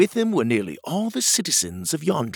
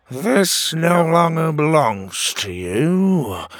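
slow, higher, drawn-out pronouncement; commanding wizard; 5 semitones higher, 3 dB louder, slower than usual. A narrator's reading. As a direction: pronounce judgement slowly as a great wizard. Wizard magically strips king of crown.

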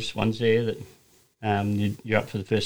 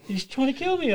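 mock frightened [name]. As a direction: whine it like a shaken mate. [name] retells being scared.

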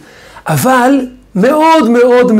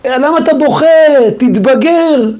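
higher, impatient mock scolding; mock yelling at child; 7 semitones higher than usual. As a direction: yell at the kid to grow up, half acted. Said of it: "אני אצעק על הילד". (צעקה מדומה על ילד בוכה)

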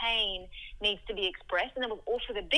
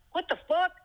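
shocked outburst; 10 semitones higher, 7 dB louder than usual. Retold or imitated. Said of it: "he was like".

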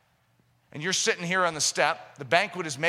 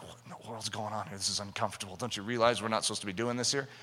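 much softer and lower, a hushed embarrassed protest; uncomfortable man; 10 semitones lower, 9 dB quieter, faster than usual. Acted. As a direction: mutter it so nobody at the party hears.

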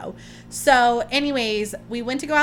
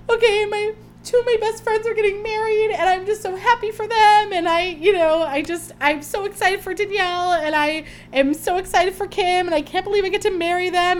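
high, excited retelling of her happy thoughts; gushing journal voice; 8 semitones higher, 3 dB louder than usual. Retold or imitated. Host recalling her journaling about friends marrying, lightly gushing. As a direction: gush about your friends' weddings. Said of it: "I was journaling and I was like".

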